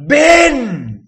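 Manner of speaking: high, loud call of "¡Ven!"; shouted name. Retold or imitated. Retelling Han calling his son on the bridge, a high loud shout. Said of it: "Y le grita".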